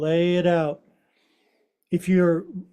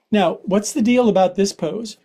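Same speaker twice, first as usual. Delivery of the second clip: ordinary delivery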